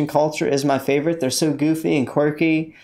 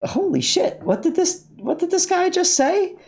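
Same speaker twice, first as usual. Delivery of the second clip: much higher, astonished "Holy shit"; shocked viewer; 13 semitones higher than usual. Retold or imitated. Viewer hearing the streamer for the first time, shocked and high. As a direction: gasp it out like you can't believe what you heard. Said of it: "you might be like".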